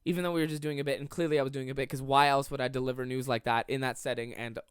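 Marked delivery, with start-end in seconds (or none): none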